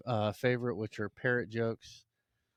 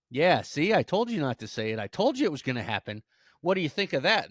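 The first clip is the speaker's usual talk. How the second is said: loud, emphatic I-told-you-so; scolding gloat; 6 semitones higher, 8 dB louder than usual. Acted. Owner taunting the parrot he punished, loud gloating. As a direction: rub it in forcefully.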